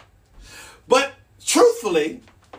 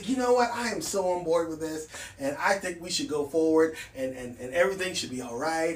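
corporate persona; 4 semitones lower, 6 dB quieter, faster than usual. Pretend. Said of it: "put on my corporate persona".

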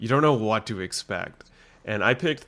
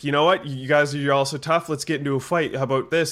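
cocky challenge; 5 semitones higher, 5 dB louder than usual. Retold or imitated. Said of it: "he's like".

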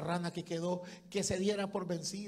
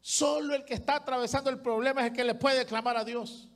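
loud, higher fervent sermon; fiery preaching; 4 semitones higher, 3 dB louder than usual. Acted. Preacher urging the congregation to praise, fervent and loud. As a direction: preach it with full force.

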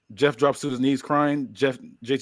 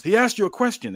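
higher-pitched, raised-voice demand; mild character yell; 10 semitones higher, 4 dB louder than usual. Acted. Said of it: "Malachi yells".

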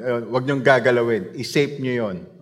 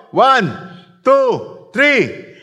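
loud count; 11 semitones higher, 7 dB louder, much slower than usual. Real, not acted. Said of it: "Ready na kayo?".

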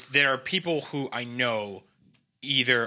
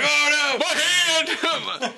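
hammed-up cry of pain; 13 semitones higher than usual. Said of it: "I'm like".

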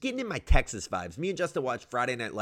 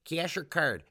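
higher, drawn-out Midwestern clerk; Metro Detroit accent; 5 semitones higher, much slower than usual. Retold or imitated. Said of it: "And they're like".

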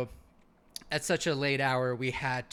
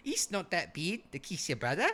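lightly voiced mock Aussie; half-laughing Aussie impression; Australian accent; 3 semitones higher, 3 dB quieter than usual. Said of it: "These are really good impressions".

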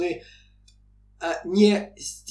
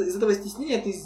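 normal talking voice

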